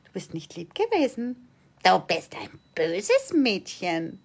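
hushed, lightly voiced reproach; hint of a disapproving parent scolding a child. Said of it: "wieder zu sagen".

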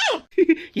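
short, sly giggle; mischievous laugh. Acted. Daughter-in-law giggling over the laxative plan, sly. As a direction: laugh at the prank idea.

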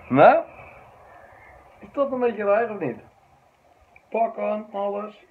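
loud and high, amused; excited. Real, not acted. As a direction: show it off loudly. Shows off his outfit.

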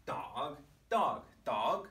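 "dog" repeated, each on a different pitch; changing pitch. Shown as a demonstration. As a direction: shift the tune on every "dog". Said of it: "I can start changing my pitch".

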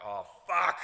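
loud, high exasperated cry; retold dismay. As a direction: blurt it out as the plan collapses. Retold dismay spotting crew off stage.

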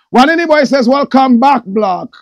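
loud, high shout-out of the comment; excited reading. Real, not acted. Reading a viewer's welcome to his live audience, loud and hyped. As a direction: hype up the viewer's greeting.

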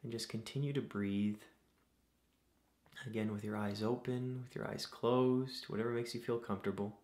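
soft, unhurried meditation voice with long pauses; guided meditation. Acted. Host leading listeners through breathing to calm anxieties, guided meditation. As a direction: guide them gently through each breath, leaving space.

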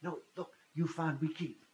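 imitated Russian speaker in halting English; broken-English Russian. Russian stranger proposing to share found money, broken English. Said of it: "in very broken English".

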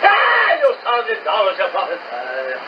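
usual speaking voice

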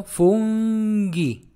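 "fungi" stretched very slowly, hard g; drawn-out model. Shown as a demonstration. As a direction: draw out both syllables of "fungi". Teacher draws out the word.

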